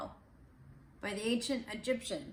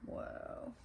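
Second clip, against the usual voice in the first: breathy whispered amazement; hushed wonder at the picture; whispered whoa; 7 dB quieter than usual. Real, not acted. Reacting to pop-up page.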